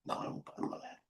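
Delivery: breathy whisper under his breath, correcting himself; whispered aside. Real, not acted. Trader whispering a correction to himself. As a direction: whisper it to yourself.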